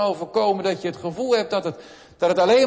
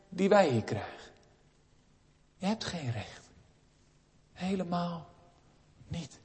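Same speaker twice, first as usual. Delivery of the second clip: quiet, falling rejection, much softer; hushed refusal; 6 dB quieter than usual. Acted. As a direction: say no gently but firmly. Jesus refuses the heathen woman. (Jezus wijst de vrouw zacht maar beslist af)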